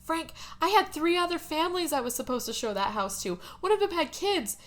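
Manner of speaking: hammed-up high-pitched fretting; George. George goes off at Frank about families, high mock-upset rant. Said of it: "George kinda goes off".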